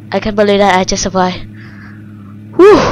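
usual speaking voice

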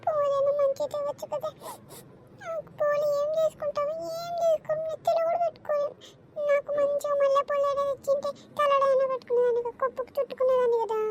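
cranky auntie complaining about flowers; grumbling mother-in-law. Acted. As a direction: scold the girl like a fussy old aunt. Mother-in-law scolding the guest.

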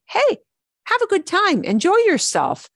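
high, pretend call to a friend; cheery urging. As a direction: act out urging someone to have fun. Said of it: "if you tell somebody, say".

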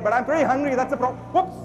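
normal talking voice

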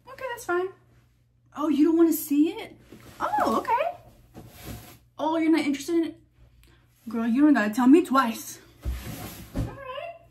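high, sing-song pretend offense; mock hurt. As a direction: play hurt that nobody's coming. Teasing someone who won't follow.

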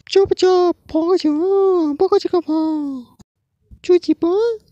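very high, squeaky chipmunk gibberish. Acted. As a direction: squeak nonsense like a cartoon. (बहुत ऊँची चूँ-चूँ बकवास आवाज़)

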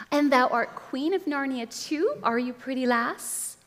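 high, lilting taunt; mocking witch. A narrator's reading. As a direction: tease with mock grandeur. Witch mocking Jill in a story.

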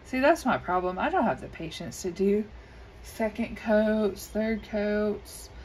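usual speaking voice

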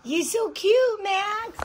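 high, slow coo to the dog. Real, not acted. Owner cooing at her dog Max, high and slow.

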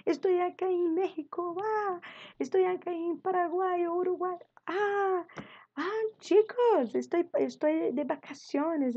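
high, excited student voice; students calling from abroad. Pretend. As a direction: play an excited student phoning from a trip. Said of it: "Ya pensaste, nosotros hablando".

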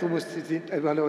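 ordinary talk, nothing marked

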